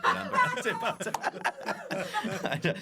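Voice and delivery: helpless high laugh through the words; giggling, barely getting it out. Real, not acted. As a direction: crack up at your own joke as you speak. Laughing at own dark joke.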